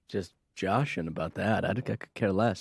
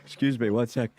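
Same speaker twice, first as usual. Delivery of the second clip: ordinary delivery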